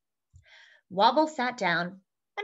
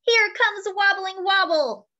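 teasing screech; 9 semitones higher, 6 dB louder, slower than usual. A narrator's reading. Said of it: "cats screeched with laughter".

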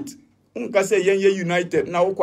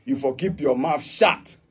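usual speaking voice

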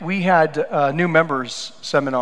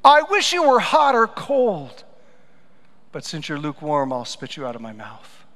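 God's frustration. Retold or imitated. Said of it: "we see God actually saying that".

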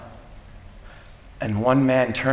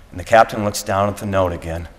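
usual speaking voice

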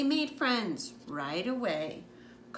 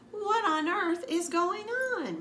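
high, loud and slow disbelief; slow, high cry of alarm; exasperated cry; 11 semitones higher, 5 dB louder than usual. Acted. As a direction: mom can't believe another dinosaur. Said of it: "my mom cried".

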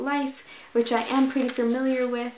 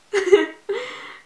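sudden high delighted laugh; amused high-pitched giggle; 10 semitones higher, 7 dB louder than usual. Real, not acted. Amused at French opening.

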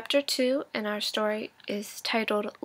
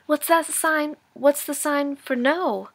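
higher, eager questions; boy excitedly asks his grandfather; 4 semitones higher, 4 dB louder, slower than usual. Acted. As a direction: ask with bursting eagerness. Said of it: "wild with excitement".

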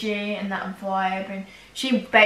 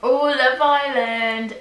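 excited; 4 semitones higher, 6 dB louder, much slower than usual. Real, not acted. Excited at the topic.

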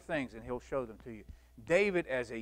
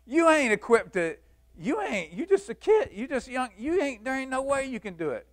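loud, high-pitched dismissal; scornful doubters; 8 semitones higher, 8 dB louder than usual. Acted. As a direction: shout him down as just a kid. Doubters scoff at young David.

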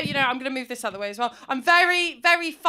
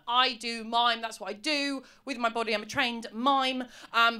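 normal talking voice

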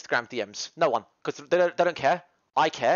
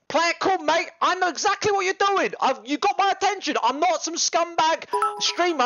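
worked-up rant; 9 semitones higher than usual. Real, not acted. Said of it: "lecturing the fan, worked up".

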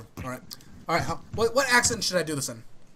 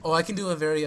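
ordinary delivery

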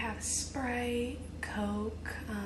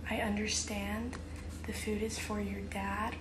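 ordinary talk, nothing marked

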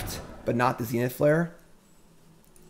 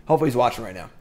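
normal talking voice

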